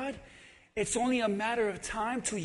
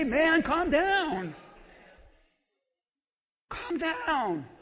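soothing calm down; 3 semitones higher, much slower than usual. Shown as a demonstration. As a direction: ease him down slowly.

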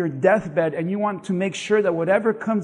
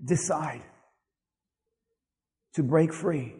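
gentle father; 3 semitones lower, 3 dB quieter than usual. Acted. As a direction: let the promise land quietly. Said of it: "notice what he said".

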